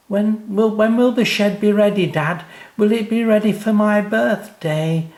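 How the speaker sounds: put-on high, loud impatient child; eager child voice. A narrator's reading. Sam pestering Dad about the shed, high and eager.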